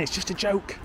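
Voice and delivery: high, softish mimicry of excuse-makers; put-on "they all say" voice; mocking stock excuse. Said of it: "that's what they all say".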